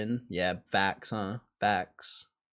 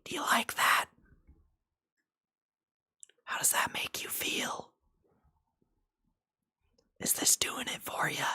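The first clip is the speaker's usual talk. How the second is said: right in your ear; 13 semitones higher than usual. Pretend. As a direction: whisper teasingly into the ear. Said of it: "I can whisper in your ear".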